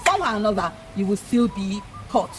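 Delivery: ordinary delivery, nothing marked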